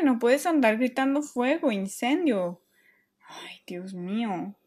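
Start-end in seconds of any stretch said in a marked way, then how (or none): 3.3-4.5 whispered, slow relief; breathless whisper. Acted. Mom catching her breath after the false alarm, whispered. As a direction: whisper it, heart still racing.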